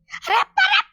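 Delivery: very high, loud yell of shock; startled shout. Real, not acted. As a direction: shriek it out in sudden surprise. Startled while searching for bird. (চমকে চড়া গলায় চিৎকার)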